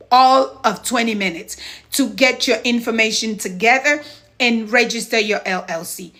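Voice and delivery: ordinary talk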